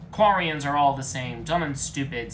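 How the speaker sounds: loud scornful insult; loud, sneering contempt; contemptuous sneer. Acted. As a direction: spit out the insult. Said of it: "said Legion with racism".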